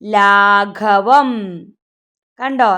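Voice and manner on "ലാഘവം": ലാഘവം very slowly, syllable by syllable; slow model reading of ലാഘവം; reading demonstration. Shown as a demonstration. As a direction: stretch out each syllable of ലാഘവം. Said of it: "എന്താ വായിക്കുക?".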